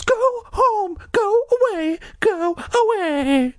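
high shaky pleading cry; trembling cry. Acted. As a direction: beg them to go, trembling. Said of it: "Dory cried in a trembling voice".

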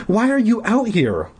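high-pitched acted demand. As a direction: press for an answer, pitched high. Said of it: "Jan demanded".

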